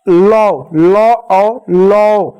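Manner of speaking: long held "लौ", spelled out sound by sound; slow sounding-out. Shown as a demonstration. Teacher showing how the matra joins ल, slow sounding-out. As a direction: draw out "लौ", then split into "ल" and "औ" and blend. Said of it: "ल पे औ की मात्रा लगेगा".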